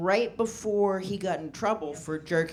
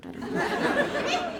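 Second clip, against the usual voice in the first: very high, slow giggled words; laughing; much slower than usual. Real, not acted. Comic to crowd, high giggling. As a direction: squeak it out laughing.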